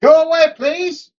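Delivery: high, slow, begging innkeeper voice; high put-on voice. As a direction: squeak out a polite brush-off. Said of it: "as they say in Old Felorian".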